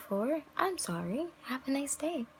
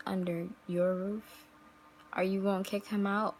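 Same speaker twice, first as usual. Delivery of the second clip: ordinary delivery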